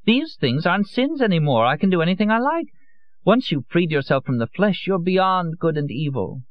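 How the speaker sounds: high, airy voice, slowing at the end; a drunk woman playing a goddess; tipsy Lorenza. A narrator's reading. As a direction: lecture him dreamily on her game. Lorenza's voice in the story.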